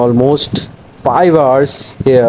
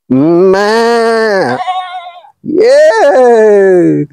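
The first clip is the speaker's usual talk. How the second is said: high excited; 8 semitones higher, 3 dB louder, much slower than usual. Real, not acted. Calling out to a goat.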